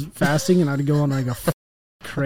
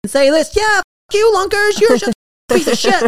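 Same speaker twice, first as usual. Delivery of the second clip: preschooler voice; 11 semitones higher, 5 dB louder than usual. Retold or imitated. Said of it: "I make fun of the f-, trolls".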